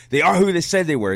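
fired-up quote of a ranting coach; coach's rant. Retold or imitated. Quoting the Vikings coach's tirade to the fans, mildly fired up. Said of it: "I'm the coach from the ... that went on that tirade".

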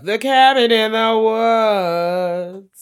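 slow, high and loud dramatic title; grand movie announcer. Pretend. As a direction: unveil the title like a glamorous host. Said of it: "introducing the movie like that?".

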